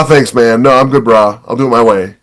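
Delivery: ordinary delivery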